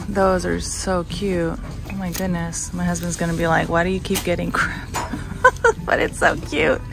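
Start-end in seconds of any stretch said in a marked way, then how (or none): none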